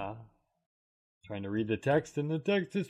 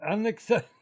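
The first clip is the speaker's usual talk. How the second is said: laughing mid-word; breaking into laughter; 4 semitones higher, 4 dB quieter than usual. Real, not acted. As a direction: crack up on the word. Cracks up on the word.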